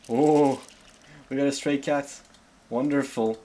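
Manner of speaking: light up at the find; excited. Real, not acted. Thrilled streamer spotting a stray cat in game.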